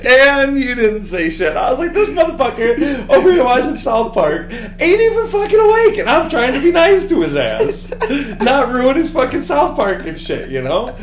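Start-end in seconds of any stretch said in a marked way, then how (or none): 1.9-11.0 much higher and louder, laughing through the words; laughing rant. Retold or imitated. Roommate mocking his friend asleep over South Park, laughing high rant. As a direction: rant it while cracking up.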